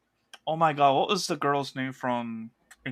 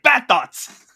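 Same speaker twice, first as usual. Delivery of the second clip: loud high self-scolding cry; shouting; 10 semitones higher, 9 dB louder than usual. Retold or imitated. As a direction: snap at yourself to stop. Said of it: "She's like".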